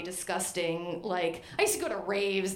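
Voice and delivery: ordinary delivery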